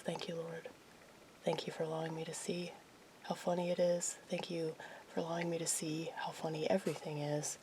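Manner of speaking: hushed, intimate prayer; whispered prayer. Real, not acted. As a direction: pray softly, almost a whisper. Quiet prayer to God.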